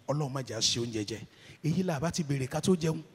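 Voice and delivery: fast muttered Yoruba; quick Yoruba aside. Real, not acted. Preacher recalling his fear at lunch, fast Yoruba aside. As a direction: rattle it off under the breath.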